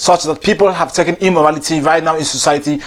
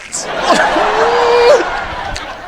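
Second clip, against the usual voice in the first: high laugh. Real, not acted. Speaker laughs at own joke.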